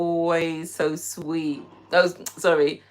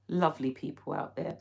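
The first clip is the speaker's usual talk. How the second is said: ordinary delivery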